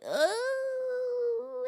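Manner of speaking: high drawn-out pain wail; pained cry. Retold or imitated. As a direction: let out a long contraction moan. Groaning in hospital hallway.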